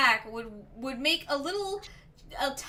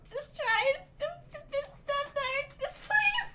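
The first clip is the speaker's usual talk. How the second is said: much higher, softer voice; strained high-pitched mock pleading; comic squeaky goblin; 12 semitones higher, 4 dB quieter than usual. Acting out Hordes caught digging.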